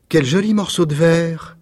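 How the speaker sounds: put-on a girl thrilled by her find; little girl's exclamation. A narrator's reading. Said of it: "s'écria la petite fille".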